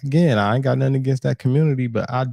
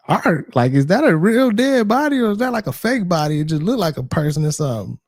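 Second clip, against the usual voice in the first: incredulous; 7 semitones higher than usual. Retold or imitated. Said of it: "she should have been like".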